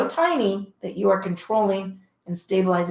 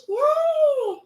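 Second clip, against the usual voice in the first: high squeal of glee; bright little victory yelp, much higher; excited cheer. Real, not acted. Cheering end of workout plan.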